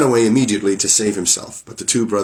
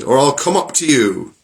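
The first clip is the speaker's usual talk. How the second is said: loud threat up the stairs; angry threat; 5 semitones higher, 3 dB louder than usual. Acted. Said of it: "cried the Bluebeard".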